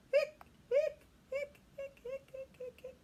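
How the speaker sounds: high hiccups fading away; hiccup sounds. Acted. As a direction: squeak them off into the distance. Hiccups fleeing.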